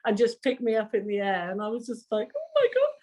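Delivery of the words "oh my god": high, squeaky awe; starstruck, tongue-tied. Retold or imitated. Singer lifted in the air by the band, high starstruck squeal. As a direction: squeak it out like an overwhelmed fan. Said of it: "I couldn't even speak properly".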